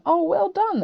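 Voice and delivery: high, loud bright well done; loud high praise; praising. Shown as a demonstration. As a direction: cheer a classmate on. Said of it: "wait until they finished and go".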